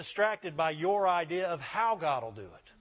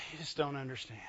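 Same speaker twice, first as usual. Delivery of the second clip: heavy sigh, then soft, low self-pity; mock pitiful; 3 semitones lower, 8 dB quieter, faster than usual. Shown as a demonstration. Preacher showing how to get people to feel sorry, mock pitiful. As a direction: sigh and murmur it like a martyr. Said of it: "You gotta say it like this".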